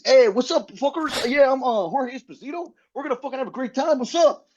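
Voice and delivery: high, brash party-host character voice; Jorge Esposito character. Retold or imitated. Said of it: "If you do this character the whole show".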